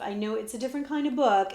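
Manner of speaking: ordinary delivery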